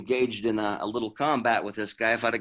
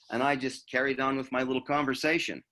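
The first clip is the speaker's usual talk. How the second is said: usual speaking voice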